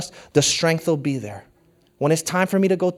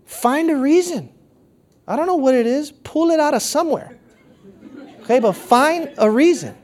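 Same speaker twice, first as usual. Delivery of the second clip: much higher, pressing the point home; excited urging; 10 semitones higher than usual. Acted. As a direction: urge it with a high, eager push. Insistent urging, pitch leaps up.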